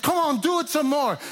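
retold taunting urging; goading teacher. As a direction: imitate a teacher urging on the mockery. Teacher egging class on to mock.

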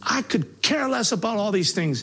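retold outburst, voice pushed up high; arguing with God. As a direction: rail at God, pitch climbing. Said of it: "having an all-out argument with God".

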